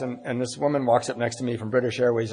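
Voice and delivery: ordinary talk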